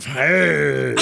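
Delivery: ordinary talk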